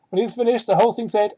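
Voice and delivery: ordinary delivery, nothing marked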